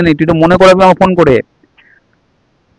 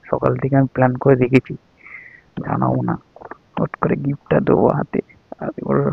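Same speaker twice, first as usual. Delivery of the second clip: soft, lowered voice to the camera; confiding hush; 4 semitones lower, 12 dB quieter than usual. Real, not acted. Husband revealing his birthday surprise to viewers, hushed. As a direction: share the secret plan without being overheard.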